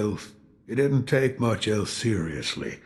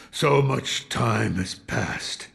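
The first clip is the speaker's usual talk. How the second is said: ordinary talk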